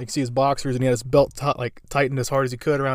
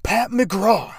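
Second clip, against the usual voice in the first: high, loud mock tough-guy name; mock tough answer; 8 semitones higher, 5 dB louder than usual. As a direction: bark the name like a defiant thug. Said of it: "He's like".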